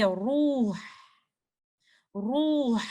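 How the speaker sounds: RUH drawn out with a thick, heavy R; full-mouth ra with dhamma. Shown as a demonstration. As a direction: model 'ruh' slowly, full-mouthed, breath on the final h. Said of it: "my letter ra is full mouth".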